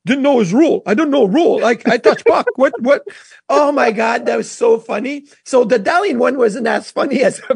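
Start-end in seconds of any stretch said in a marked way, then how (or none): 0.1-3.0 put-on baffled protest in a Russian accent; imitated Russian accent, short insistent phrases; Maxim Afinogenov. Hockey player still protesting after the game, Russian accent. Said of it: "Max in his Russian accent is like".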